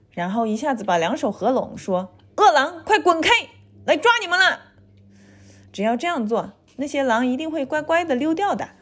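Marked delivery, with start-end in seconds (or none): 2.4-4.6 high, forceful warning call; acted high-pitched challenge; bold threat. A narrator's reading. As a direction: scare the wolves away. Mother shows how to scare wolves.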